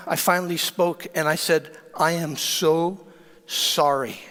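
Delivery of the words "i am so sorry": slow, grave apology; drawn-out, subdued sorrow; sorrowful. Retold or imitated. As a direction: let each word land heavily, with regret. Hears friend will be shot.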